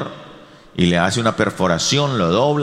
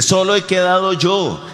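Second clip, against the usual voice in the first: self-pitying Elijah; 8 semitones higher than usual. Acted. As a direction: whine it like a prophet left alone. Elijah complaining to God. (Elías quejándose a Dios, agudo y lastimero)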